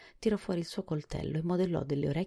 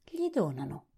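mildly admiring remark. A narrator's reading. Jack admires the horse's new ears, mild admiration. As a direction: say it with a touch of admiration. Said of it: "disse Jack con ammirazione".

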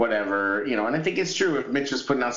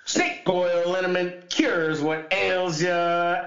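snake oil seller; 11 semitones higher, slower than usual. Pretend. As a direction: shout like a carnival pitchman.

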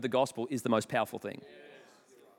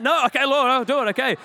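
higher, louder, giving in to God; exasperated surrender, louder; 7 semitones higher, 7 dB louder than usual. Retold or imitated. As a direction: throw up your hands and give in.

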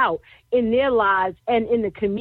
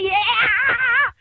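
crowd call; 9 semitones higher than usual. Shown as a demonstration. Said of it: "Let me hear you say".

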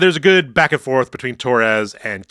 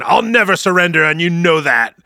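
defiant Q; 5 semitones higher, 4 dB louder than usual. Retold or imitated. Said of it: "uh, Q saying".

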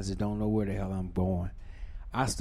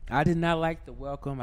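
normal talking voice

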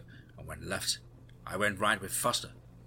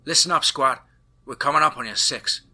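loud, higher call over the comm; radio call; 6 semitones higher, 10 dB louder than usual. A narrator's reading. Tammy calling the squad over the comm, loud call.

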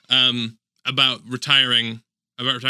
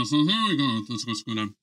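underwater; 3 semitones lower, 5 dB quieter than usual. Real, not acted. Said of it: "You should do the rest of the podcast like".

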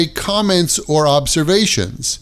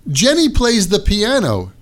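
ordinary talk

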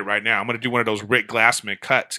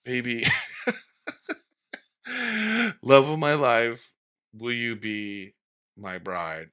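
rehearsing a proposal; 5 dB quieter, slower than usual. Retold or imitated. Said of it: "and I would be like".

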